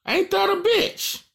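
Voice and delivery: high, slow, stretched-out outrage; disbelief. Real, not acted. Rapper scoffing at the stolen song title, slow and high. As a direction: drag it out in mocking disbelief.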